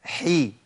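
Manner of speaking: "حي" with a long ee vowel; ha with kasra. Shown as a demonstration. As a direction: slowly model "حي" with kasra. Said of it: "الكسر".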